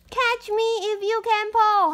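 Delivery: high, singsong teasing voice; teasing taunt. Acted. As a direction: taunt him playfully from afar. Peanut costume taunting.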